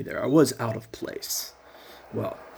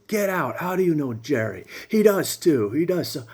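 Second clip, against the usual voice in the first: high, loud outraged yelling; shrill angry order; 7 semitones higher, 5 dB louder than usual. Retold or imitated. Driver throwing the hitchhiker out, high and outraged. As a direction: throw him out of the car, indignant.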